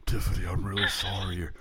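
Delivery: lightly mocking, maudlin line reading; maudlin Alf. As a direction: imitate an over-sentimental line reading. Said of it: "maudlin, um, line reading from Alf".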